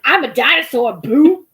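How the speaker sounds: put-on loud, sassy kid's boo. Kid in dinosaur costume telling hosts off, sassy and loud. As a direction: fling the boo with attitude. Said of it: "All sassy".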